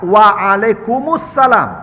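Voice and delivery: waalaikumsalam said slowly and clearly; slow, careful teaching reply; slow demo. Shown as a demonstration. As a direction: model waalaikumsalam at a slow pace. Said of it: "Kalau orang Islam".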